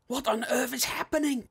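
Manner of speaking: high, far lisping voice. A narrator's reading. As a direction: lisp the words in panic. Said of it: "Bixby lisped".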